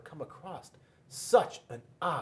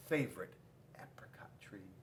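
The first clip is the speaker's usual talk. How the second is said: whisper; 6 semitones lower, 12 dB quieter, faster than usual. A narrator's reading. Mysterious hushed reveal.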